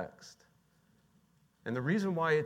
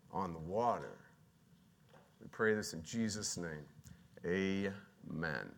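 prayer; 4 semitones lower than usual. Real, not acted. Preacher prays to Jesus for the congregation, prayerful. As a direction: settle gradually lower as the prayer unfolds.

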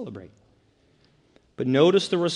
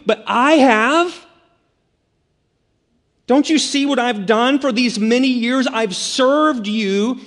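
louder and higher, building to an indignant cry; resentful older son; 8 semitones higher, 8 dB louder than usual. Retold or imitated. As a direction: throw it back in the father's face, growing louder. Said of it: "He's saying, Listen".

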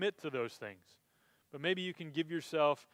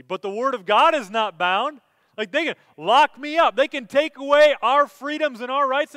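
loud, high, driving sermon delivery; impassioned preacher at full volume; full-force preaching; 6 semitones higher, 9 dB louder than usual. Acted. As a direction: preach it out with rising fire.

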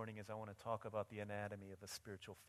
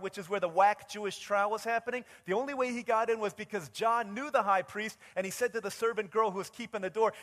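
raised, high-pitched sermon storytelling; forceful preaching; 11 semitones higher, 12 dB louder than usual. Real, not acted.

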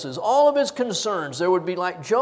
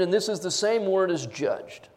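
ordinary delivery

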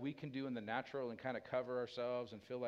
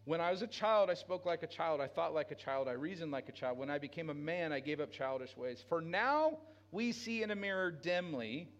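loud, higher, fervent preaching voice; full-force preaching; 5 semitones higher, 5 dB louder than usual. Acted. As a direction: drive it home to the congregation.

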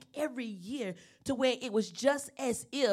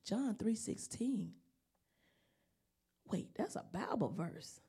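whispered wonder; 7 dB quieter than usual. Retold or imitated. Remembered inner wonder.

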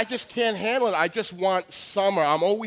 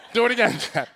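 imitated son; much faster than usual. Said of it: "my son's like".

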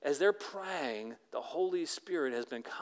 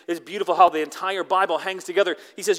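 usual speaking voice

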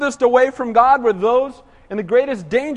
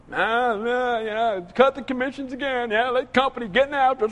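mock whining; 5 dB quieter than usual. Worker moaning about cut commissions, mock whining. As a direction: moan half under your voice, imitating a whiner. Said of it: "when we're whining".

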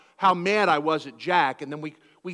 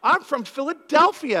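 higher, louder and slow; indignant retort; 8 semitones higher, 8 dB louder, slower than usual. Retold or imitated. Pastor retorting to the churchgoer who called him Southern, indignant. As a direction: insist on it, offended.

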